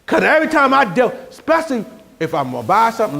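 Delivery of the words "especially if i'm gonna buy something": ordinary talk, nothing marked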